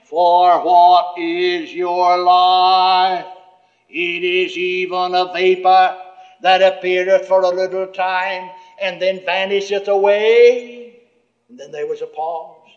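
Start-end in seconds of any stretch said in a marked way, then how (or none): 0.0-11.0 very slow, drawn-out prophetic voice; slow, grave voice of scripture; solemn heavenly voice. Retold or imitated. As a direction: intone it like a voice from above. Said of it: "The voice said".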